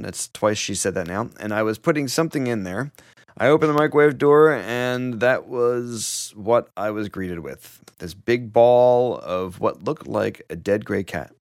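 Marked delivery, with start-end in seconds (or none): 1.4-11.3 fast then slowing to a crawl; dramatic reading. Retold or imitated. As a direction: speed up then drag out the reveal. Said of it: "Denise said".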